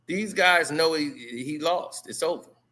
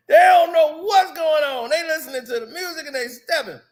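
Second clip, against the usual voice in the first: louder, higher hype call; hyped repeat; 9 semitones higher, 6 dB louder than usual. Pretend. Repeating the line hyped up, louder. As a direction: pump the line up.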